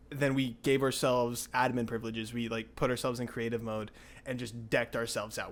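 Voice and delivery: usual speaking voice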